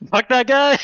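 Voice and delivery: high, loud mock outrage; joking shout. Real, not acted. Game master echoes a player's jab at the villain, shouting. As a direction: yell it like the table's cheering.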